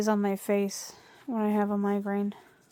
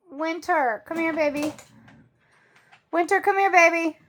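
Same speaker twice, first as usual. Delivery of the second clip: calling the dog; 6 semitones higher, 3 dB louder than usual. Real, not acted. Calling dog to stop barking.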